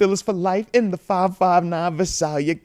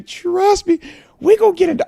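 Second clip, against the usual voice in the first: insist, pitched up high; emphatic high; 7 dB louder than usual. Real, not acted. Promising viewers he'll explain the trade, high emphatic. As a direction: jump way higher and louder on it.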